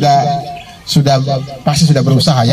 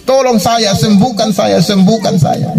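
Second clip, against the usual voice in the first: high, repeated mock cries; retold cry for help; 6 semitones higher, slower than usual. Beggar who never stops calling to Jesus, mock pleading shout. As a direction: call out pleadingly in a high pitch. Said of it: "Dia teriak".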